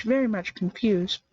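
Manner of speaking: plain reading voice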